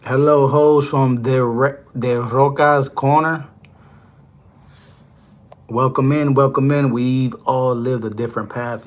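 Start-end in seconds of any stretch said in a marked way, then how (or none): 0.0-6.9 stream greeting. Real, not acted. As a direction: draw out the welcome to your chat. Opening greeting to stream viewers.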